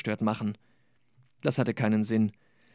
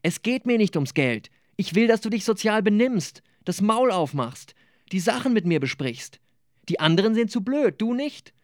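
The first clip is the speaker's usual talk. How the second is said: higher-pitched, agitated acted lecture; heated; 8 semitones higher than usual. A narrator's reading. Gabi urging Nicola to talk things over, heated. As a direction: get worked up and press the point. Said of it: "Er wurde hitzig".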